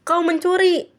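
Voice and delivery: high-pitched indignant cry; acted outraged yell; shouted accusation. A narrator's reading. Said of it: "teriak Laura".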